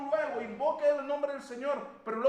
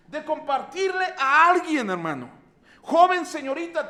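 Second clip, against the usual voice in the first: loud pulpit appeal; preaching; 4 semitones higher, 10 dB louder than usual. Acted. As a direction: call out to the young people. Preaching to the congregation.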